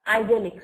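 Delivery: IDYLLIC loud, as eye-DEL-ik; US pronunciation. Shown as a demonstration. Teacher repeating the US English form, IDYLLIC said loudly. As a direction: punch out "Idyllic" with a long first i. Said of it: "So in U S English".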